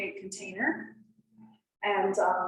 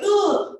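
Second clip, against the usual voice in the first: high, loud startled ugh; a jolted noise of alarm; mock startled grunt; 12 semitones higher, 7 dB louder than usual. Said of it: "I was like".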